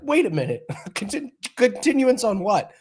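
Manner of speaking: high, laughing mock shock; hammed-up baffled rep; mock outraged surprise. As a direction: squeal it out in disbelief while cracking up. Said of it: "the Wawa is like".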